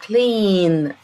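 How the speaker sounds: clean said slowly with a long stretched ee. Shown as a demonstration. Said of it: "بنكسر فيهم جامد".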